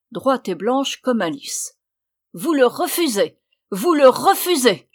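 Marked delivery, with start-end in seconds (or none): 2.3-3.4 high, loud, incredulous anger; roaring outrage. A narrator's reading. Witch hearing the sister refuse, loudly outraged. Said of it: "rugit la vieille". 3.7-4.9 higher, very loud fury; full roar. A narrator's reading. Witch repeating the refusal in disbelief, roaring. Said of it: "rugit la vieille".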